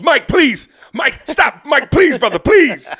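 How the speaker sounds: high, loud, panicked pleading; begging security guard. Retold or imitated. Security guard begs Mike to stop, high panicked squeal.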